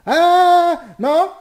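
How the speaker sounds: very high, loud shriek of fright; startled scream. Real, not acted. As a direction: jump out of your skin. Sudden monster scare.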